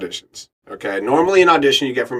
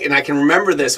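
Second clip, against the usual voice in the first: usual speaking voice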